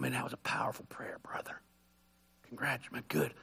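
hushed, impressed churchgoer whispering; mock whispered praise. Whispering to the pastor after his showy prayer, mock awed.

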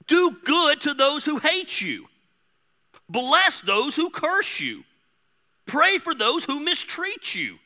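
solemn Bible reading; scripture reading. Acted. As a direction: read the verses out like from the pulpit. Reads scripture aloud.

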